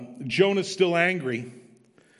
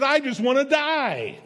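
much higher, slow, whiny mock despair; sulking Jonah; 10 semitones higher, 3 dB louder than usual. Preacher mimicking angry Jonah wanting to die, whiny. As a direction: pout it out like a sulky prophet. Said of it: "he's so ticked that it's successful".

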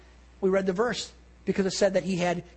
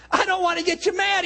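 anxious pleading; 10 semitones higher, 4 dB louder than usual. Retold or imitated. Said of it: "he pleads with them".

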